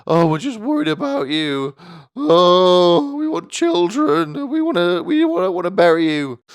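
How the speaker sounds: high, loud whiny mum; mum's nagging voice. Retold or imitated. Mum nagging her son about eating, high and loud. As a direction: whine like a worried nagging mother. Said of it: "when they're getting at me".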